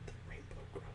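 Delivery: breathy whisper of the title. Shown as a demonstration. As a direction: breathe "The Rainbow Crow." as a secret. Title whispered after saying it.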